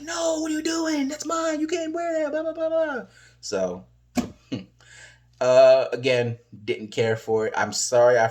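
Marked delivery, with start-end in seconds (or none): 0.0-3.1 high-pitched mock protest; mock whine. Eva Marie whining about her stolen jacket, high mock protest. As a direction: whine it like a girl whose jacket was taken. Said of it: "She's like".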